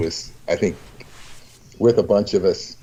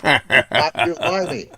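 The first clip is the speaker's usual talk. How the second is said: mock English accent; 9 semitones higher, slower than usual. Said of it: "panacea for anything an Englishman runs across".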